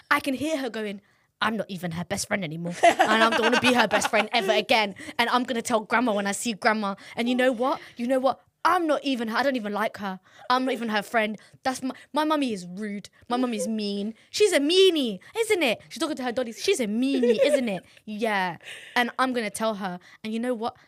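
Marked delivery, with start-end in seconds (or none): 1.4-15.8 put-on loud, indignant little-girl rant; sulky toddler rant. Daughter sulking to dollies. 16.6-20.7 put-on loud, indignant little-girl rant; sulky toddler rant. Daughter sulking to dollies.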